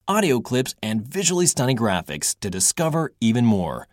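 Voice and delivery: bright, high-energy commercial voice; upbeat ad read. Acted. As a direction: sell it to kids with excitement.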